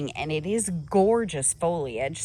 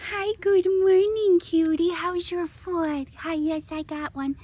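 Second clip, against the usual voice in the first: high, sing-song greeting to a pet; high cooing voice for a pet; pet talk; 9 semitones higher than usual. Real, not acted. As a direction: coo at her like a beloved animal. Greeting her pet squirrel Sweetie.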